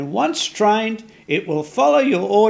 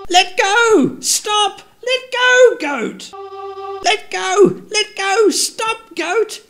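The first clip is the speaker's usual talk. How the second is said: frantic shouting; 9 semitones higher, 3 dB louder than usual. Acted. Elch yells as the goat eats the whip.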